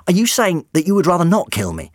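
high, tense disbelief; high alarmed doctor. Acted. Doctor pleading with monster.

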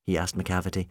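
plain reading voice

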